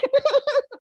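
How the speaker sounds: high hammed-up cub sob; Baby Bear sobs; mock sob. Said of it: "started crying again".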